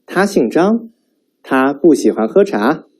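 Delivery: 他姓张 and 他不喜欢喝茶 with a rising end; rising question tune. Shown as a demonstration. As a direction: ask both, ends lifted. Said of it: "with a rising intonation".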